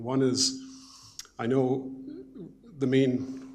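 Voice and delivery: words ringing back through the room; echoing. Real, not acted. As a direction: voice echoing in a big hall. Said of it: "sorry, I'm echoing here".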